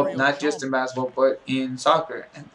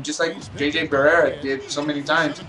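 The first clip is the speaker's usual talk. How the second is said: ordinary talk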